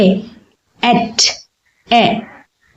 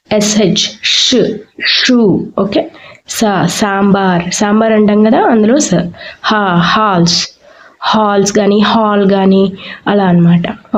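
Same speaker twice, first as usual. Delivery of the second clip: usual speaking voice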